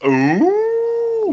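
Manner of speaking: high excited squeal; excited ooh. Real, not acted. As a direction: squeal with delight. Reacting to the rumours.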